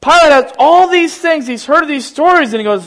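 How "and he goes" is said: usual speaking voice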